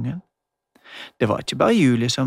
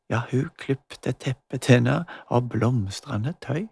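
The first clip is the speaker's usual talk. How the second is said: plain reading voice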